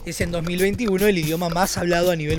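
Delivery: informative report read. Acted. Answering Juan's question about world languages, documentary narrator read. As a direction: read it as a measured factual report. Reads the researched answer to Juan.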